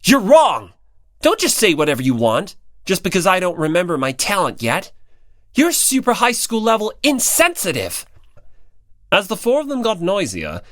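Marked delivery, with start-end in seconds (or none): 0.0-8.1 louder, higher indignant shout; loud retort. A narrator's reading. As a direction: shout him down in protest. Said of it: "Hinata's loud voice".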